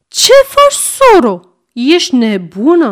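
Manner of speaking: shocked scolding. A narrator's reading. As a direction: scold her daughter for shaking the boy. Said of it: "zice mama mare". (bunica o ceartă pe mamiță, mult mai sus și mai tare)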